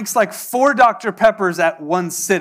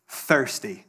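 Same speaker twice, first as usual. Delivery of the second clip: mock dismissive teen; 8 semitones lower, 6 dB quieter than usual. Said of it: "look at him and be like".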